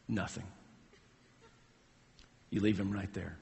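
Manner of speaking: low, soft, unbudging verdict; calm firmness. Retold or imitated. Dad telling head coach to leave his son, quiet resolve. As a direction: say it quietly, with long pauses.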